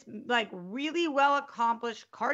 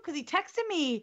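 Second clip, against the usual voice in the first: normal talking voice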